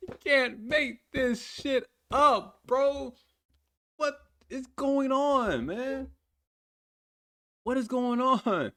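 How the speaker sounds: high, slow, drawn-out disbelief; what on earth am I watching; incredulous. Real, not acted. Disbelief at the music video.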